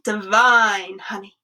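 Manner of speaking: savoured slowly. A narrator's reading. Narrator to children, slow and savouring. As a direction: draw out divine honey slowly, relishing it.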